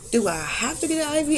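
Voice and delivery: high-pitched reluctant plea; retold reluctant protest. Patient to doctor who ordered an IV, reluctant protest. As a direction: re-enact pleading your way out of it.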